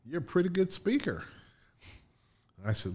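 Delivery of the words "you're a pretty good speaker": high-pitched put-on presidential voice; President Clinton. Retelling the president's compliment, high imitated voice. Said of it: "and he said".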